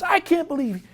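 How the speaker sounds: high, loud exasperated manager; high, angry manager voice. Retold or imitated. Manager berating his players over the book, high and loud. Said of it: "He says".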